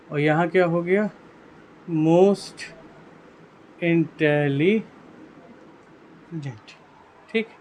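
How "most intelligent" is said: drawn-out words with long pauses; very slow, piece by piece; slow dictation. Shown as a demonstration. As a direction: stretch each syllable as if writing it down. Dictating slowly while writing it.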